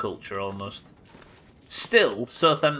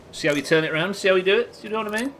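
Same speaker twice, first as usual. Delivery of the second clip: smug teasing; 8 semitones higher, 4 dB louder, faster than usual. Pretend. Mock boasting about Brits.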